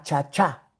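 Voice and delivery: normal talking voice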